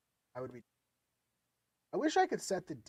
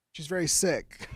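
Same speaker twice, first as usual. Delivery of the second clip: ordinary talk, nothing marked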